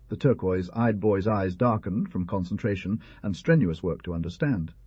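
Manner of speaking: ordinary delivery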